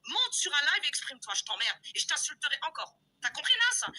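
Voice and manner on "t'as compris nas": louder, higher challenge; angry shout. Real, not acted. Daring the rival [name], an angry higher shout. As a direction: shout the question at her.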